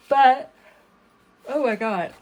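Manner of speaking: yawning mid-sentence. Real, not acted. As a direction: talk through a yawn. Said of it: "I don't know why I'm yawning".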